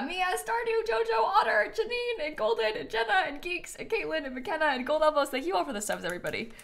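high rapid name list. Real, not acted. Reading sub names.

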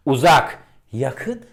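Uzak called high and loud as if far away. Shown as a demonstration. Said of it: "İnce ton ve biraz şiddetini arttırıyorum".

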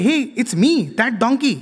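a forgotten donkey begging notice; donkey pleading. Retold or imitated. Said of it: "it is trying to say".

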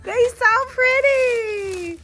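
high, slow, gushing and tearful. Real, not acted. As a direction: swoon over the flowers, voice high. Gushing over flowers.

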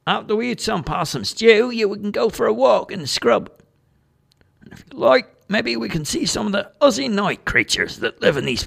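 lightly voiced broad Aussie; hearty Aussie Dano. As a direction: sell the outback with gusto. Dano boasting about bush food.

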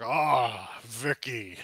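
a touch of a booming ogre on the ah; big friendly hail to an old pal; hearty ogre greeting. Acted. Ogre hailing his old friend, hearty and loud.

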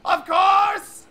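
high, loud, drawn-out insistence; raving prophet. Acted. Prophet insisting the Minotaur is the beast, raving. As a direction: shout it as wild proof. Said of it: "you know, raving".

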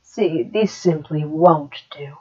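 low, breathless demonic whisper; eerie whisper. Acted. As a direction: hiss the words to yourself, unseen.

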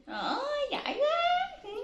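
very high, drawn-out squeal of praise; delighted praise. Real, not acted. Mother praising child for a kiss, delighted squeal.

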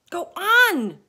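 high, loud urging; frantic. A narrator's reading. Travellers frantically urging James. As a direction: press frantically. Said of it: "they said frantically".

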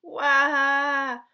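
put-on a baby screams for the daylight box; baby wail. A narrator's reading. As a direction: let out a newborn's demanding wah. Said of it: "The baby would scream".

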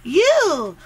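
angry accusation. Acted. Hurling blame back in a quarrel, high outburst. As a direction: burst out, stretching the first word.

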